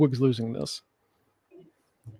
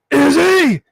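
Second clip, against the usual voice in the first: higher, louder mock yell; mock scream; 9 semitones higher, 6 dB louder than usual. [name] pointing out Izzy's name, mock yell. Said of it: "why are you screaming like that".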